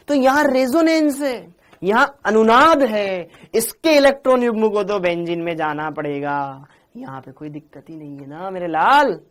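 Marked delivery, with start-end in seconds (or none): 0.1-3.3 resonance and अनुनाद said high, loud and slow; emphatic teaching; stressed terms. Shown as a demonstration. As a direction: drive home the key terms. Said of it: "stressing key term".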